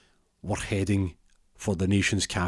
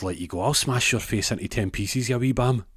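put-on glasgow street accent; 3 semitones higher than usual. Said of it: "He goes".

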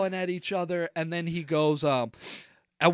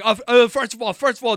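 loud, higher flustered imitation; defensive Jersey guy; 6 semitones higher, 7 dB louder, faster than usual. Imitating the roommate deflecting about the houseguest, defensive Jersey guy. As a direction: mimic a Jersey dude butting in to object. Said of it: "like, old school Italian, like, Jersey dude".

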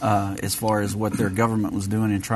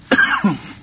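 coughing fit; 8 semitones higher, 4 dB louder than usual. Real, not acted. Host swallowed wrong, coughing.

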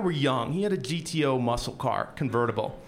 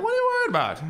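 breezy urging; faster than usual. Retold or imitated. Tim urging him to drive.

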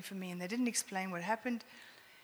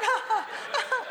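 put-on higher, louder laughing growl back at the baby; laughing mock growl; 14 semitones higher, 7 dB louder than usual. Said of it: "And I'm like".

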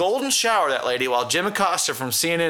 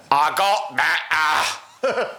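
put-on very slow, mangled Cajun accent; 3 semitones higher, much slower than usual. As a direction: stretch every vowel in horrible mock Cajun. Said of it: "the Cajun's version of that".

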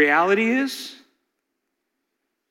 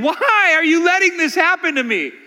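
crying out; 9 semitones higher than usual. Acted. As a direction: beg God for an answer. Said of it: "crying out to him, saying".

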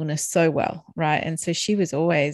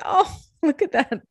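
laughing fondly; 10 semitones higher, 4 dB louder than usual. Retold or imitated. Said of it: "And I was like".

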